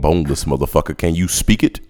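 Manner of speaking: loud, insistent yell. Pretend. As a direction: yell it at them. Caller yells order.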